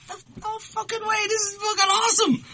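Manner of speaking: very high, squealing thrill; kid's excitement. Retold or imitated. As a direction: gush like a starstruck schoolboy. Retold kid's excitement about [name].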